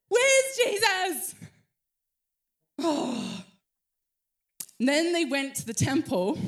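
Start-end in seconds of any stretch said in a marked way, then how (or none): 0.1-1.3 high, loud, slow "Where's Jesus?"; frantic search. Acted. Mother searching the crowds calls out frantically. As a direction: call out frantically for the lost boy.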